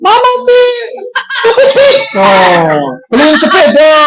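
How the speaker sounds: high-pitched fake bawling for parents; hammed-up whining child begging; mock crying wail. Ustaz imitating a child demanding a bicycle, mock wailing. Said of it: "Nangis-nangis, jerit-jerit, goar-goar".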